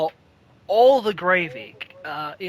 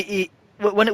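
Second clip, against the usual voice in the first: usual speaking voice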